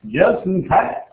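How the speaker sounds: rising, sing-song "Just in case"; playful echo. Acted. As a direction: toss it back lightly, teasing. Teacher repeats phrase twice.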